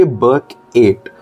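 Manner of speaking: "Burkett" slowly split as "burk... eight"; mnemonic pronunciation. Shown as a demonstration. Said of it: "can also be pronounced as Burkett".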